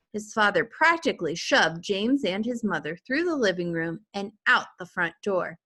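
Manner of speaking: ordinary delivery, nothing marked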